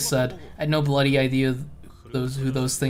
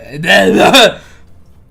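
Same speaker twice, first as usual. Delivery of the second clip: loud, higher laughing burst; 5 semitones higher, 13 dB louder than usual. Real, not acted. Streamer laughing at the twist, loud burst. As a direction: let out a sudden laugh.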